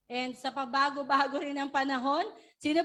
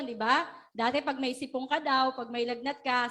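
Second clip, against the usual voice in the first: usual speaking voice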